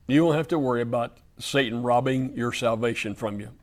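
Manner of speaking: usual speaking voice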